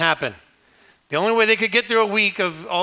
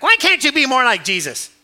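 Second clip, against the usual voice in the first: high, loud mock scolding; nagging mom; 7 semitones higher, 5 dB louder than usual. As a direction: imitate a mother nagging her other kids. Nagging mom comparing her sons.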